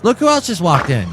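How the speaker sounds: ordinary talk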